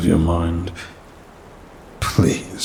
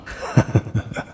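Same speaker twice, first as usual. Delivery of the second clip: short chuckle; 4 semitones higher than usual. Acted. Demon amused at human's poor planning, chuckling.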